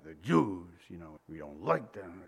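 low, disdainful mock snob; sneering prejudiced voice. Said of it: "they say it like that".

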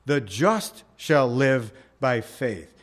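slowly, just leaned on hard; slow reading that lands on just; stress on just. Shown as a demonstration. Preacher reading Habakkuk's verse to the church, stressing just. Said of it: "the emphasis is on the just".

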